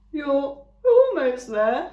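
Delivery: friendly eager ram; Thud the ram. A narrator's reading. Ram introducing himself to the robot, eager. Ram's voice.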